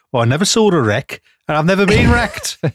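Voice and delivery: put-on Staffordshire voice, pitch leaping up; Staffordshire accent. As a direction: do a hammed-up Staffordshire captain. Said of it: "That's not how Staffordshire people, he would say".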